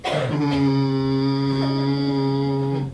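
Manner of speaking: long loud hydraulic truck whine; truck noise. Retold or imitated. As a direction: imitate a garbage truck's lift. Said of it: "And the truck goes".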